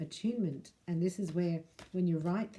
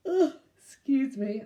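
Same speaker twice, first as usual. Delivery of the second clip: higher, slow yawn through the words; 7 semitones higher, 4 dB louder, slower than usual. Real, not acted. Host apologises to viewers mid-yawn, a slow yawning excuse me. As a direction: let a real yawn stretch the words. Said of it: "I haven't yawned for so long".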